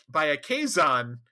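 ordinary talk, nothing marked

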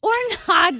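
real laugh breaking into the words. Real, not acted. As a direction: burst out laughing as you say it. Laughing at friend's joke.

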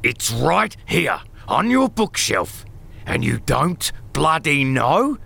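angry interrogation. Acted. Boss interrogating cowering Lionel.

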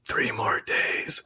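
whispered slow and ominous; creepy whisper of a countdown; eerie whisper. Pretend. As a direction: breathe it like a horror-movie omen. Creepy reply to wrong numbers.

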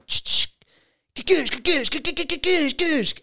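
high, loud rapid-fire sound effect; loud mouth-made sound effect, high pitched; imitated whooshing noise. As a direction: spit out percussive ka-goosh noises. Imitates a noise mid-story.

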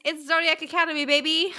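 hammed-up excited shout-out; hyped reveal. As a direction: announce it like a game show reveal.

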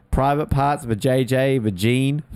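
ordinary talk, nothing marked

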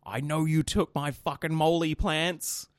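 loud, high, drawn-out mock scream; retold scream. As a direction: yell the accusation slowly over the fence. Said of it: "Someone is screaming".

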